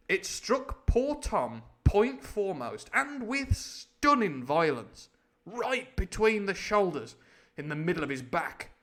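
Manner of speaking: slow, loud, high storytelling; dramatic narration. A narrator's reading. Narrator telling of the crutch strike, dramatically. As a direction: drive home each blow of the phrase.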